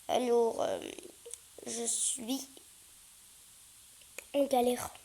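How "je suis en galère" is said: hushed whisper, half to himself; soft whispered grumble; whispered aside. Real, not acted. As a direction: whisper it like a private complaint.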